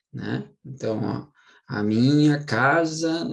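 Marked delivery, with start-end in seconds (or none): 1.7-3.3 "casa" drawn out slowly and louder, stressed on ca; slow demonstration of "casa" with its first syllable strong. Shown as a demonstration. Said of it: "eu falo a minha casa". ("casa" devagar, com o ca tônico)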